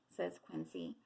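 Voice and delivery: usual speaking voice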